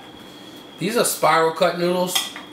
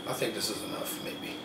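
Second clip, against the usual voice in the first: much softer, under his breath; muttering to himself; 7 semitones lower, 15 dB quieter than usual. Real, not acted. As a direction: mumble it to yourself. Quiet answer to himself.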